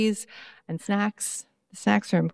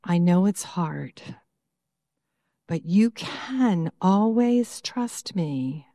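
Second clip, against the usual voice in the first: guided meditation. Acted. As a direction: lead the children into calm. Leads a guided prayer.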